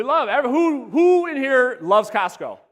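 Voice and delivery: rally the crowd with it; calling out. Real, not acted. Calling out to the audience.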